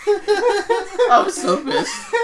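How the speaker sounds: high, very drawn-out squeal of laughter; laughing exasperation. Real, not acted. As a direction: stretch it out, helpless with laughter. Recounting anger while laughing.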